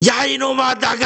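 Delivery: high, sharp shout of an angry wife; retold angry yell. Wife yelling at returned husband to get out, high shout. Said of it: "하고 소리를 백낙같이 지르더래요".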